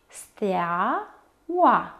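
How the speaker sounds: two syllables held apart: stea, oa; despărțit în silabe. Shown as a demonstration. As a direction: stretch "stea", pause, then "oa". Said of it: "Avem doi diftongi". (rostit rar, pe silabe)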